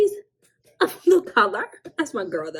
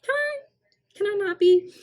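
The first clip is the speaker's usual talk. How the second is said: much higher, coy "can I"; playful high pleading; 13 semitones higher, 4 dB louder than usual. Retold or imitated. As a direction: beg in a squeaky joking voice. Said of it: "I will always be like".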